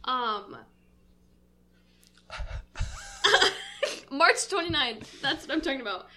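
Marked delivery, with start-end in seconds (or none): 2.3-4.1 high breathless laugh. Real, not acted. After bug attack.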